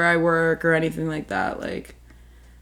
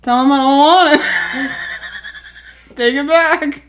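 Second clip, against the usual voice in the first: mock refusal; 6 semitones higher, 7 dB louder than usual. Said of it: "I was like".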